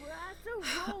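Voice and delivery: soft, high exasperated sigh; exasperated exhale before explaining yourself; frustrated sigh. Acted. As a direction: breathe out in acted frustration. Voicing a frustrated mother's reply.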